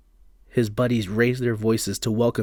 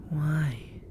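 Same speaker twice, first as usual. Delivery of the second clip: breathy spirit whisper; eerie whisper; 6 semitones higher, 6 dB quieter than usual. Acted. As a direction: ask like an ancient ghost. Spirit asks.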